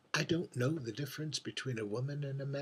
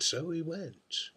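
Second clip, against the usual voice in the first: ordinary delivery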